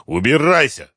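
mild angry yell. A narrator's reading. Inspector orders the addict out, restrained yell. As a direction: give a contained yell of dismissal. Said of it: "орал Коклюшный".

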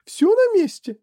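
very high, loud frantic mother; anxious cry. A narrator's reading. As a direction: check on your child in a panic. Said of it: "закричала миссис Ти Ви".

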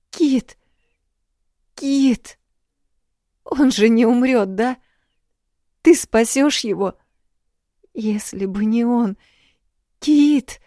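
high, loud panicked begging; desperate pleading. Acted. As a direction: plead through rising panic. Dasha begs help for dying dog.

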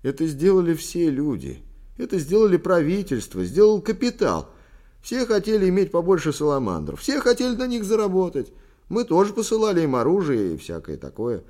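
loud, high-pitched protest; vehement, impassioned rebuttal; heated outburst. A narrator's reading. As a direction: argue it at full voice, escalating. Son hotly argues with father.

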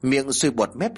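plain reading voice

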